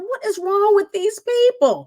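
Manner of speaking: high, drawn-out exasperated disbelief; incredulous. Retold or imitated. Recruit says to herself after gum hollering, incredulous. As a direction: wail it in mock disbelief.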